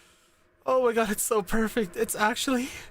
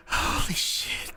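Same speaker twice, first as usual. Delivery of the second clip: ordinary talk, nothing marked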